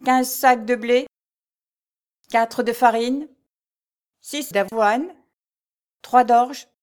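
high, loud, slow listing of dues; slow pompous steward. A narrator's reading. Steward slowly reading out every due owed. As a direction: savour each item of the levy. Steward reads long list.

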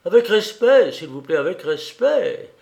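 high, loud start, easing down; mock-indignant, lightly amused exclamation; laughing protest. A narrator's reading. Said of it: "ajouta-t-elle en riant".

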